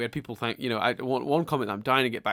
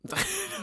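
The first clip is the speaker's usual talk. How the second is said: short, high laugh; 4 dB quieter than usual. Real, not acted. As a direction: laugh at your own joke. Laughs at own joke.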